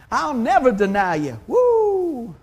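loud, proud vow ending in a whoop; a loud mock vow from Peter, capped with a whoop; Peter's loud boast. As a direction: swear it loudly like a bold disciple.

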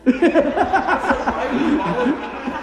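high, loud burst of laughter; real laughing fit; hearty laughter. Real, not acted. Preacher cracks up at his own story, hearty laughter.

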